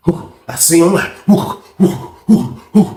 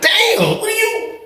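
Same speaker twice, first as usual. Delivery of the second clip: high astonished yelp; can't believe it; mock shocked cry; 3 dB quieter, much slower than usual. Shocked reaction.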